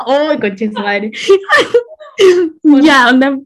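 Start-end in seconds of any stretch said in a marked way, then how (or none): 1.2-2.8 loud, high, helpless laugh that cracks like a sob. Real, not acted. Telling her friend the story, she bursts out laughing.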